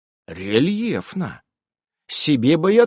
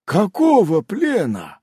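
embarrassed; 7 semitones higher, 4 dB louder, slower than usual. A narrator's reading. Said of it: "несколько смущённый Мёбиус".

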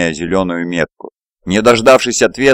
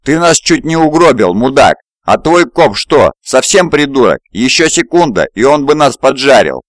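furious, raised sergeant's bark; angry sergeant; 6 semitones higher, 3 dB louder than usual. Acted. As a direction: chew him out loudly. (разъярённый сержант)

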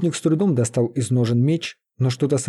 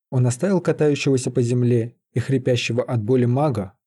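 ordinary delivery

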